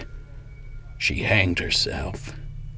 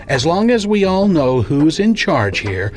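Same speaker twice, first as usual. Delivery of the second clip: higher, louder, domineering; asserting authority; 8 semitones higher, 5 dB louder than usual. Acted. Boss telling caller who's in charge, domineering. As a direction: make clear who is boss.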